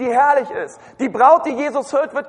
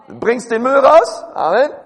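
louder, drawn-out mock motherly order; mother's chore request; 3 semitones higher, 6 dB louder than usual. Mother telling her child to take out the rubbish, insistent. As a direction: imitate mum, slow with a lift at the end. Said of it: "Meine Mutter hat immer gesagt".

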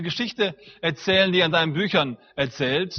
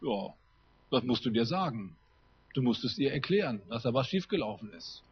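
dry matter-of-fact; 5 semitones lower, 8 dB quieter than usual. Retold or imitated. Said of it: "sagt ganz trocken".

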